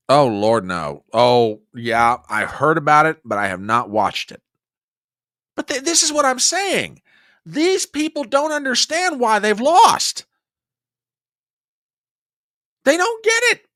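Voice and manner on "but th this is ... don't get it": exasperated. Real, not acted. Rising exasperation at opponents.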